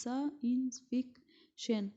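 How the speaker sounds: science fiction said very slowly; teacher sounding out four syllables; slow syllable demo. Shown as a demonstration. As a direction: lay out science fiction beat by beat. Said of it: "Here we have four syllables".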